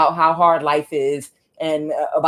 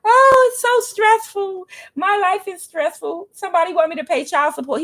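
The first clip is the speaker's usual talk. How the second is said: mock the baby father's self-pity; over-the-top whiny complaint from the ex; mock whining ex; 4 dB louder than usual. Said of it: "my baby father had a breakdown".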